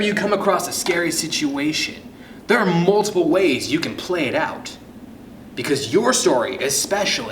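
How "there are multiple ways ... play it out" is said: plain reading voice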